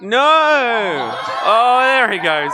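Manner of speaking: high, loud cry sliding down; alarmed shout. Real, not acted. Spectator watching climber step back off the tree, alarmed. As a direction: cry out as if watching someone fall.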